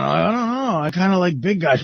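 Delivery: high, coy put-on woman's voice; stripper caller. Girl answering Levy about getting on, in a high put-on voice. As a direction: pitch it well up as the girl. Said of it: "how do you wanna get on?".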